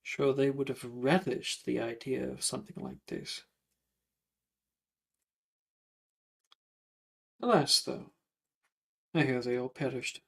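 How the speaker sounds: lightly voiced old gentleman, wistfully pausing; understated lament in a ghost's character voice; rueful ghost. Ghost telling students that those people perished, rueful.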